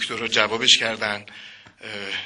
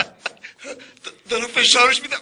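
much higher, strained and pushing; straining high voice; 12 semitones higher, 4 dB louder than usual. Pretend. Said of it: "بلندتر".

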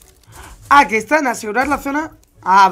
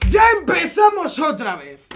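raised, high-pitched complaint; exasperated outburst; 4 semitones higher, 3 dB louder than usual. Real, not acted. Streamer having to do it all over, exasperated shouting. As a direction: cry out in exasperation.

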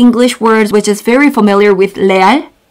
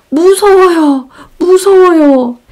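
higher, frightened voice for a horror movie; scared; 6 semitones higher, much faster than usual. Shown as a demonstration. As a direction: act spooked by a scary film. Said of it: "And horror movie".

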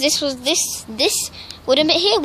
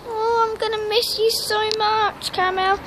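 tearful goodbye; 7 semitones higher than usual. Real, not acted. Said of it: "so I don't know why I'm so upset".

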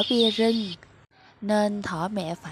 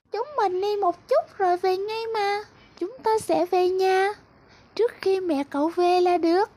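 very high, light butterfly voice; high sing-song butterfly luring the bunny out to play; cheery little butterfly; 10 semitones higher, 3 dB louder than usual. Acted. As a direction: chirp it like a tiny butterfly coaxing a friend. Butterfly coaxes rabbit again.

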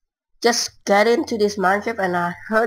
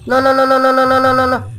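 loud, higher frantic string of no; real panic as the zombie closes in; panicked no's; 6 semitones higher, 6 dB louder, faster than usual. Real, not acted. Zombie attack, game lagging.

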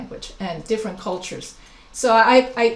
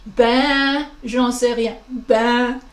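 a French "Ben, j'en sais rien" with a louder Ben, said like a shrug; casual French "Ben, j'en sais rien"; French shrug phrase; 3 dB louder, much slower than usual. Shown as a demonstration. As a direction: shrug it off in French, face sagging. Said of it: "In France, you go".

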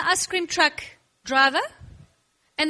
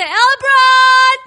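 high, slow booming call; loud inviting call; 10 semitones higher, 13 dB louder, much slower than usual. Pretend. Preacher invites Elbron to the ice cream castle, loud high call. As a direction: call him over grandly. Host calls kid to castle.

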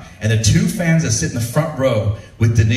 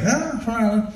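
higher put-on voice correcting; Irish correction; 10 semitones higher, 4 dB quieter, faster than usual. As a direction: mildly imitate the Irish man. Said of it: "He's like".

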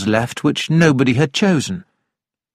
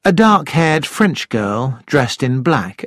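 ordinary delivery, nothing marked

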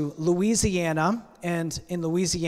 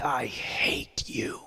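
slow, whispered hiss of hatred; bitter whisper through clenched teeth; through her teeth; 4 semitones lower, 4 dB quieter, much slower than usual. Acted. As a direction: spit the words out in a whisper. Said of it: "said through her teeth".

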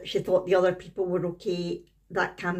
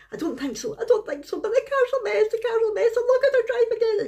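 high-pitched, loud, panicky wail climbing ever higher; imitating hysterical daughter; 4 dB louder than usual. Mother imitating her hysterical daughter after the crash, high panicky wail. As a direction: retell the daughter's hysteria, voice rising to a squeal. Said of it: "And she was really, really hysterical".

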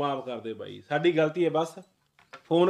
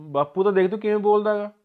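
loud, raised-pitch complaint; angry protest; 7 semitones higher, 10 dB louder than usual. Acted. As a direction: burst out in heated objection. Son protests about father's words. (ਗੁੱਸੇ ਵਿੱਚ ਉੱਚੀ ਸ਼ਿਕਾਇਤ)

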